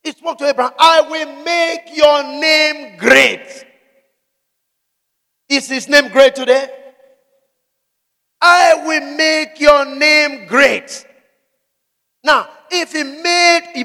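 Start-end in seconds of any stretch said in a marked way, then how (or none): 0.8-3.6 preaching at full force. Acted. God's promise proclaimed. 8.4-11.1 preaching at full force. Acted. God's promise proclaimed again.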